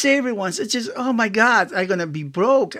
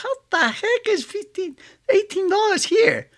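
mock price shock; 12 semitones higher than usual. Shocked at online toy prices.

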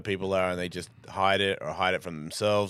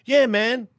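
eager fan; 12 semitones higher, 5 dB louder than usual. Retold or imitated. Fans answering, eager.

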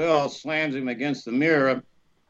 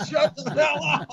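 laughing disbelief; 11 semitones higher than usual. Real, not acted.

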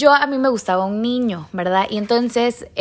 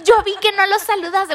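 much higher, tearful angry protest; teen outburst; 11 semitones higher than usual. Retold or imitated. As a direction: reenact her teenage self crying at mom. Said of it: "me puse a llorar y me enojé".

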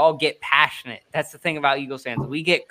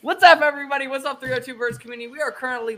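excited; 8 semitones higher, 6 dB louder than usual. Real, not acted. Host opens the live stream.

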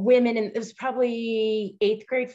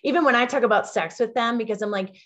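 ordinary delivery

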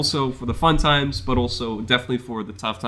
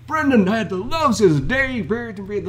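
mock whiny complaint; 10 semitones higher than usual. Mocking a dramatic complaint.

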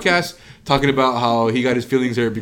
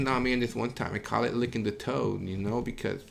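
softer, rambling stoner story; mock laid-back Mike; 4 semitones lower, 10 dB quieter than usual. Mike telling the DMT story, soft and rambling. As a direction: mumble the toad story dreamily.

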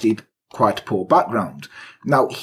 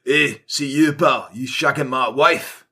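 mock Scottish accent, drawn out at the end; Scottish husband; slower than usual. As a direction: play an aggrieved husband in broad Scots. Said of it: "he was thrown out by the husband".

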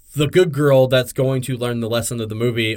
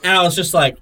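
normal talking voice

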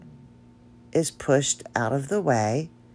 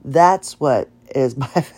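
normal talking voice

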